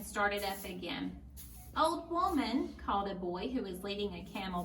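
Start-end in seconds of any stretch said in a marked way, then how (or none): 1.8-2.7 higher, lightly voiced young boy calling; a hint of an eager boy hailing her. Camel boy calls to Befana, higher eager voice.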